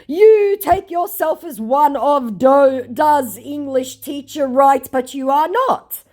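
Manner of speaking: high, slow put-on scammer; mock scammer voice. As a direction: drag out the scammer's retort. Voicing the scammer.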